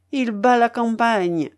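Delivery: loud, tipsy call; mild drunken shout. A narrator's reading. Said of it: "se mit à crier Razumikine d'une voix qui trahissait l'ivresse".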